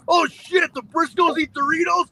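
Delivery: high, giddy fanboy rant; squealing with fan excitement; excited fanboy. Retold or imitated. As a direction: gush like a starstruck mark. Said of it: "I was like".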